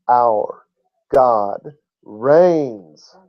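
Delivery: "Our", pause, "God", pause, "reigns"; spaced out, each word left to ring; measured proclamation. Real, not acted. Preacher answering what the good news is, measured.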